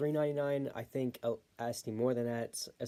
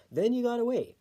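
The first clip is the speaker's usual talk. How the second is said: sing-song; 12 semitones higher, 6 dB louder than usual. Real, not acted.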